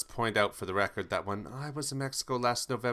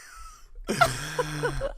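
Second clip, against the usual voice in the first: high squeal laugh. Real, not acted. Laughing at shambles remark.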